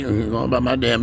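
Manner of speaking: ordinary talk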